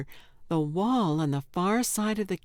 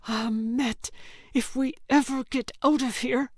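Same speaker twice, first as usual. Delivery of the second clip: hissed through teeth; 6 semitones higher than usual. A narrator's reading. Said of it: "hissed Yelda through gritted teeth".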